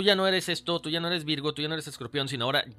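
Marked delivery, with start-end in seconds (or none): none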